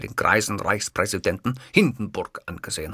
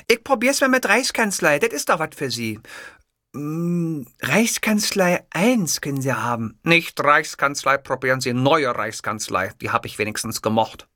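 higher voiced dialogue; 6 semitones higher, 3 dB louder than usual. Clerk and Hitler voiced.

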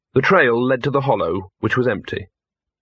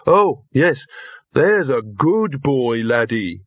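praising a dog; much slower than usual. A narrator's reading.

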